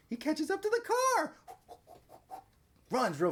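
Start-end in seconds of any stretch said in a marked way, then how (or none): 0.1-1.3 excited. Real, not acted. Dad retelling chase excitedly.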